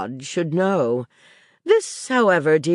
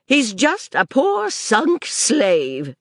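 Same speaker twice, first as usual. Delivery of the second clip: sudden energy; 7 semitones higher, much slower than usual. Acted. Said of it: "she asserted with sudden energy".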